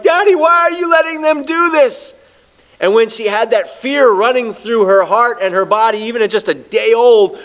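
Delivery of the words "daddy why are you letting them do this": terrified newborn's plea. Retold or imitated. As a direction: cry out like a frightened baby girl. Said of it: "she looked at me almost like".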